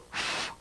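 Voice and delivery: long puff of air; blown breath. Shown as a demonstration. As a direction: blow out hard, as if into someone's face. Demonstrates blowing breath on someone.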